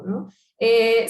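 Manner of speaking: normal talking voice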